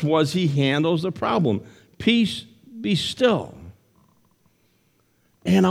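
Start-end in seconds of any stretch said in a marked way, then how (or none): 2.0-3.5 slow, hushed and higher, stilling the storm; a gentle slow command to the sea; calm command. Acted. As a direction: speak peace over the waves very slowly. Jesus calms the storm.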